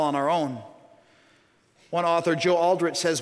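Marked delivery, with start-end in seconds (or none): none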